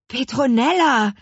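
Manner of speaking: high, loud astonished shout of a name; startled and delighted; surprised call. A narrator's reading. The twins spot the witch behind them, surprised. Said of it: "Erstaunt sahen sich die Zwillinge um".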